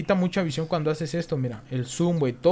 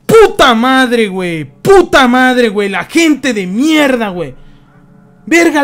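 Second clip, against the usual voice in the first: loud, higher furious ranting; angry rant; 7 semitones higher, 13 dB louder than usual. Real, not acted. Streamer raging at the people who killed him, loud and high. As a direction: vent the rage at the other players.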